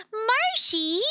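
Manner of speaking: high delighted gasp of the cat's name; light up at seeing the cat; excited gasp. Real, not acted. Greeting her cat.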